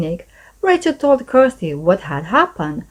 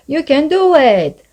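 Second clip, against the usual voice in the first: higher, louder cheering call; 7 semitones higher, 4 dB louder than usual. A narrator's reading. Rachel cheering the unicorn boat, loud. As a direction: urge the boat on. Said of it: "called Rachel in her loudest voice".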